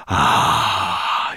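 long, loud breathy sigh of relish; gloating dragon's sigh. A narrator's reading. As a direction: sigh out with gleaming satisfaction. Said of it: "he sighed".

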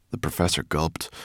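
ordinary delivery, nothing marked

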